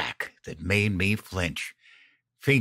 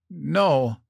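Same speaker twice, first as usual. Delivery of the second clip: higher, pained groan; groaned refusal; 7 semitones higher than usual. A narrator's reading. Wife refuses to apologize, mild pained groan. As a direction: groan the word out in mild pain. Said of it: "she groaned".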